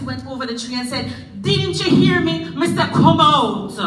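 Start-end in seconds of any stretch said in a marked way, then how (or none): 1.4-3.8 loud, high, indignant challenge; indignant demand. Acted. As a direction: scold the silent figure. Anansi angrily confronts tar figure.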